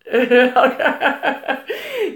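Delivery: long rippling laugh rising at the end; real giggling fit. Real, not acted. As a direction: break into a drawn-out giggle mid-thought. Amused at people's behaviour.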